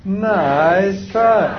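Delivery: high, drawn-out singsong "nice try"; cheerfully unfooled parent, stretched out; big-grin comeback. Shown as a demonstration. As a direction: sing it through a big grin. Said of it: "What's the answer?".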